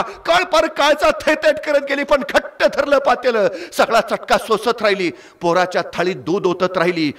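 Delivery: emotive Marathi storytelling, rising with the drama; story narration. A narrator's reading. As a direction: tell it like a village storyteller moving the crowd. Storyteller narrating village tale.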